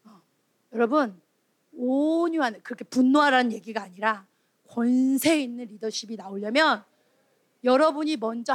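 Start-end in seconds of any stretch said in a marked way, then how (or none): none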